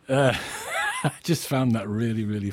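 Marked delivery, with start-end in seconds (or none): 0.4-1.0 high giggle. Real, not acted. Laughs again at answer.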